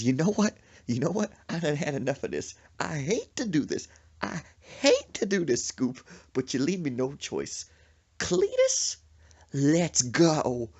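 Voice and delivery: high, loud exasperation, acted out; fed-up mama. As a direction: imitate a mama at the end of her rope. Acted quote of fed-up mama fighting.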